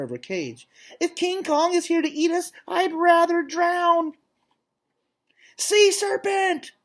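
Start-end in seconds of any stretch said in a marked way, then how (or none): 1.0-4.2 a tiny terrified rabbit; frightened bunny. Acted. 5.6-6.7 very high, loud slow shriek; beagle's scream. Acted. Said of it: "screams the beagle".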